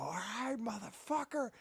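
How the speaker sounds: half-scared fake bravado; mock tough threat. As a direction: bluff the burglar, voice climbing. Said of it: "and I go".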